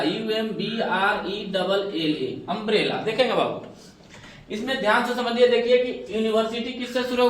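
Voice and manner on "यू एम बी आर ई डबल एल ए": slow, letter by letter: यू एम बी आर ई डबल एल ए; spelled out letter by letter. Shown as a demonstration. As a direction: spell the word out slowly, one letter at a time. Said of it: "जैसे हमने लिखा umbrella".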